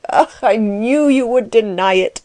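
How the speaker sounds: gloating exclamation with a lift in pitch; crowing at being proved right; gleeful cry. A narrator's reading. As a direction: cry out in nasty triumph. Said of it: "cried Klutz, slapping his leg and grinning horribly".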